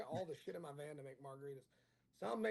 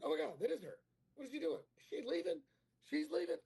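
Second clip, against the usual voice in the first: high-pitched, disbelieving outburst; incredulous shock; 12 semitones higher, 4 dB louder than usual. Retold or imitated. Comic tells friends his date is walking away, high incredulous shock. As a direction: re-enact watching in disbelief, pitch climbing.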